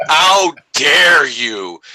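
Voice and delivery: mock outrage. Dad mock-outraged at his kid's Star Wars gift. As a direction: ham up being scandalized by the gift.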